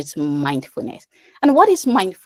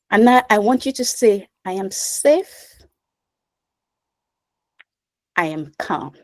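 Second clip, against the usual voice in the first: guided meditation; 2 semitones higher than usual. Acted. Leads a breathing exercise.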